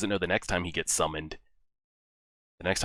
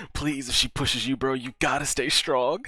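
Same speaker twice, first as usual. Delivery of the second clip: higher-pitched, urgent plea; pleading; 6 semitones higher than usual. Real, not acted. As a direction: beg them to hold firm. Pleads with character on screen.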